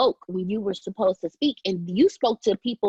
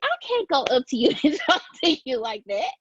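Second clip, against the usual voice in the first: ordinary talk, nothing marked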